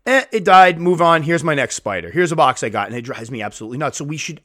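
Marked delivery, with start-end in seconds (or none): 0.1-2.9 louder, higher, offhand brush-off; mock dismissive keeper. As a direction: imitate someone blithely moving on. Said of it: "folks that just kinda go".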